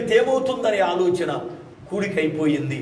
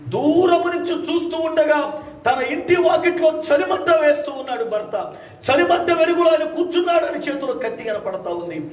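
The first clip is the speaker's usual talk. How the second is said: preaching at full force; 10 semitones higher than usual. Acted. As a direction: proclaim it to the whole congregation. Preacher telling story at full force.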